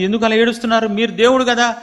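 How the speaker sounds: high, loud bewildered plea to God; Samuel pleading. Acted. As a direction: beg him to say why he weeps. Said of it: "సమూయేలు". (సమూయేలు దేవుడిని అడుగుతూ, ఎక్కువ స్వరంతో వేడుకోలు)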